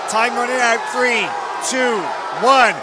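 loud, high countdown call; excited countdown. Real, not acted. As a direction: count down the last seconds. Countdown at the end of the match.

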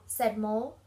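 ordinary delivery

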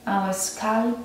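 unhurried meditation guide, long pauses between phrases; guided meditation. Acted.

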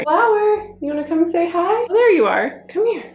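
high-pitched coaxing pet voice; high singsong dog-owner voice; calling the dog. Real, not acted. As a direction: call your dog over sweetly, way up high. Calling her dog over.

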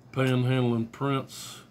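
usual speaking voice

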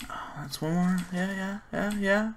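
usual speaking voice

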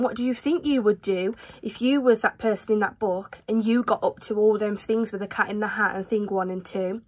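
usual speaking voice

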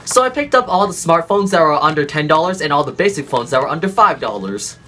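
ordinary delivery

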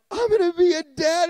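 high, loud burst of excitement; overjoyed new dad. Retold or imitated. Husband hearing wife is pregnant, excited and high. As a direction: blurt it out, thrilled.

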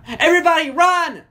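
high, loud alarm cry; high-pitched urgent shout; urgent calling out. A narrator's reading. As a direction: yell a warning to everyone. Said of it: "Smurfette called out".

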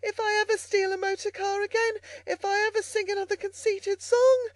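high, pitiful Toad voice; desperate vow from someone swept downriver; gasping regret. A narrator's reading. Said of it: "gasped poor Toad".

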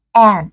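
AND with a tense, nasalized a, half a half e; căng, hơi mũi. Shown as a demonstration. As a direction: say AND with the vowel pulled tight toward e. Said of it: "đáp án số hai". (AND âm a căng, hơi mũi)

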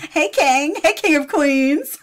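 high, loud, teasing hail; mock greeting. Commentator greeting a lookalike in photo, loud mock greeting. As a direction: hail him in mock greeting between laughs.

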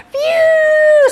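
very high loud whooshing cry; wild whoosh. Acted. Girl racing through the house, loud high whoosh. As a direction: shriek up high like a rushing gust.

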